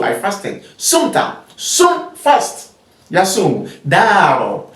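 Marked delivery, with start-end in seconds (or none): 0.8-2.7 much higher, breathy sum and fast; modelling the word. Shown as a demonstration. Teacher modelling sum for students. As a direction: lift high and airy on sumta. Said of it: "you can say the word".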